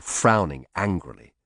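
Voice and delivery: usual speaking voice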